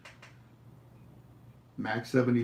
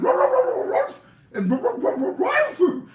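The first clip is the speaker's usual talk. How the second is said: high, loud yappy barks; rapid string of high barks; dog barking; 7 dB louder than usual. Acted. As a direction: bark like a little dog reading lips. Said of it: "and the dog said".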